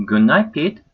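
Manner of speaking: firm, final good night; great finality. A narrator's reading. Mother ending the argument, with great finality. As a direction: close the discussion for good. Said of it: "said with great finality".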